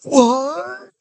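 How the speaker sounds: high, loud, stunned "What?"; shocked what. Retold or imitated. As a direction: blurt it out in total disbelief. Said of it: "and I was like".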